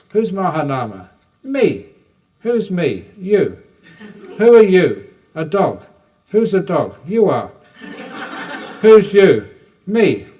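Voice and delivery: loud, quick back-and-forth between two monks; rapid loud exchange. Retold or imitated. Retold debate, rapid loud exchange.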